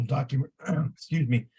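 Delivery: losing his voice; hoarse voice, throat clearing; raspy whisper. Real, not acted. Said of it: "I'm losing my voice".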